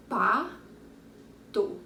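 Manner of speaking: "Pato" split as Pa, to with a clear pause; slow two-beat "Pato" for counting; syllable by syllable. Shown as a demonstration. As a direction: say each half of "Pato" on its own. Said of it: "Quantas sílabas tinha o pato?".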